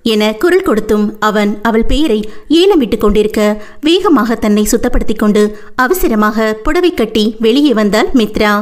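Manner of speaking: usual speaking voice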